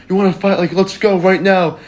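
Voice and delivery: lightly acted goading, volume held back; mock challenge. Guy comes up to the group again, mock challenge.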